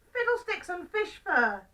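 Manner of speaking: high, loud storybook indignation; exasperated outburst. Acted. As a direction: exclaim it in mock outrage. Old man vexed by wrong food.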